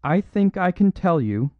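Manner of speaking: high, loud, brave but quivering; a courageous woman speaking up in public; clear, quivering voice. A narrator's reading. Lady Margaret speaking up for O'Brien, clear quivering voice. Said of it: "in that clear, quivering voice".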